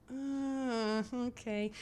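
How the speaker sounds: long high uh, hesitant okay; stall, then accept doubtfully; uneasy reaction. Acted. Viewer seeing the dead rabbit, awkwardly unsure. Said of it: "and you're like".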